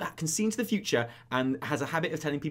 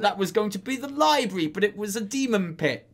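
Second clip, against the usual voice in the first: apologetic bumbler; 4 semitones higher, 6 dB louder than usual. Retold or imitated. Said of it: "He's like".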